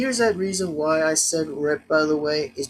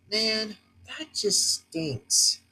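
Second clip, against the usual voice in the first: disappointed; 5 semitones higher, 3 dB quieter, much slower than usual. Real, not acted. Griping about team loss.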